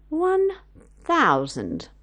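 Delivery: teasing pause; dramatic pause. Acted. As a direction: drop the sum heavily. Mrs Larchmont raises the offer.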